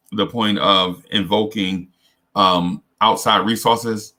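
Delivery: ordinary talk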